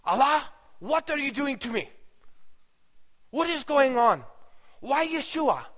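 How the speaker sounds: high, upset demands flung at God; half-acted, bewildered anger; indignant outburst. Aishin, angry at Allah over Yeshua, with high indignant questions. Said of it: "Aishin was ticked off".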